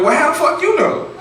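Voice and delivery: high, loud outraged retort; high outraged squeal; indignant squeal. Retold or imitated. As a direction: squeal it back, caught off guard.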